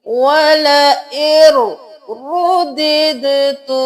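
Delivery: वला एर रुद्दितु, slow and stretched; slow tajweed reading. Shown as a demonstration. Teacher demonstrates the correct reading. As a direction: read it slowly, syllable by syllable. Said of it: "हमने रुद्दितु किया".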